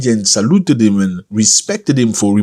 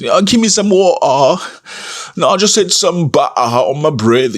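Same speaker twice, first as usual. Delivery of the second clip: put-on posh British accent, a bit higher; mock British accent; 6 semitones higher than usual. Africans back from two weeks in Britain, mock British accent. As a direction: ham up a fake British accent after a short trip. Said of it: "they come back with an accent".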